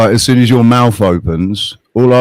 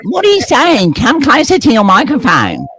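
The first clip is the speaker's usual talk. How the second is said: high cartoonish mock deafness; mocking squeak; 11 semitones higher than usual. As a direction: squeak back like you can't hear him. Mocking feigned deafness, pitched way up.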